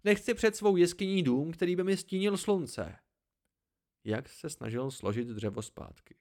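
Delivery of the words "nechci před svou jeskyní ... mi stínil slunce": put-on high, louder objection from the old hermit; mild exclaimed protest. A narrator's reading. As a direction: protest in a raised, higher voice. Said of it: "vykřikl stařec". (starcův zvýšený, protestující hlas)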